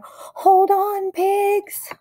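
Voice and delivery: high piercing call to hold on; urgent call. A narrator's reading. Explorer guinea pig rallying pigs in quake, high cry. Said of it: "cried Fluffy the Explorer".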